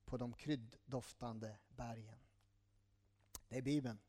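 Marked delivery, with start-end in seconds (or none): 0.0-2.2 scripture reading. Acted. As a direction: read the verse slowly and gently. Reads Song of Songs aloud. (långsam, mjuk bibelläsning)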